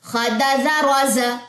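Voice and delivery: slow, sing-song syllables; rhythmic reading. Shown as a demonstration. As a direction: read it in one steady rhythm. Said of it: "लय में पढ़ेंगे".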